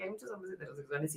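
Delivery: under her breath, uneasy in the crowd; quiet self-talk. Retold or imitated. Telling friend of her unease, murmured softly.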